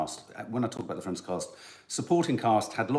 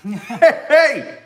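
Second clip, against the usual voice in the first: high, loud laughing hey; loud shout; 13 dB louder than usual. Real, not acted. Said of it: "way too loud".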